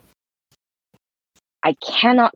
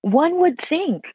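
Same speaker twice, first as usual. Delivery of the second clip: dry, knowing reply; wry; 11 semitones higher, slower than usual. Real, not acted. Wry reply to niche idea.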